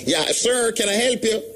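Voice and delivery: high, polite foreign clerk voice; airport clerk. Retold or imitated. Host imitating an airport clerk abroad, high and chirpy. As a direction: put on a chirpy desk agent. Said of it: "see them and say".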